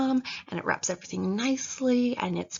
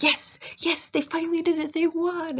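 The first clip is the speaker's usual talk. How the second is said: much higher, breathless cheering; 10 semitones higher than usual. Retold or imitated. Reader cheering for the characters, high excited mock cheer. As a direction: cheer like your team just won, in mock joy. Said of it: "Or they're like".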